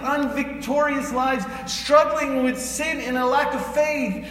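rising preacher's challenge; full-force preaching. Acted. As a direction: push the congregation to believe.

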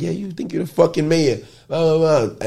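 high, sing-song ribbing; teasing mockery. Drunk friend teasing him as the mayor, high mocking tone.

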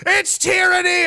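high, slow drawn-out whine; mock whiny complaint. Mocking a protester crying tyranny, slow high whine. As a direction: stretch it out like a whiner.

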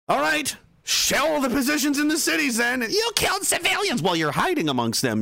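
high-pitched acted outrage; mock exasperation. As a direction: blurt it indignantly, pitched high. Voices an exasperated exchange between sides.

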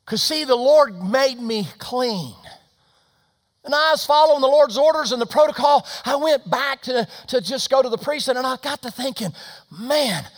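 high, shouted sermon climax; full-force preaching. Real, not acted. Preacher tells what the healed are grateful for, full-force preaching. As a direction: preach at the top of the voice, driving it hard.

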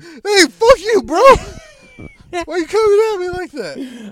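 high, loud, playful outrage; mock offended yell. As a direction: yell it back, fake offended. Mock-offended comeback, shouted.

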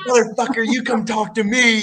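furious callout; angry shout. Retold or imitated. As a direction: yell back while held in a headlock. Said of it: "I'm like".

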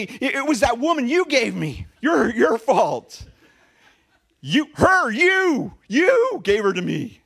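high, loud panicked blame, stammering; quick blaming Adam. Acted. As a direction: shout the excuse fast, pointing the finger. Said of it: "Adam was so quick to say".

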